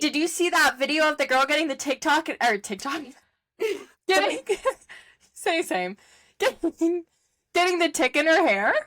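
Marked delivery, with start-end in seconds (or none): 3.6-8.7 laughing. Real, not acted. Laughing while recalling a video.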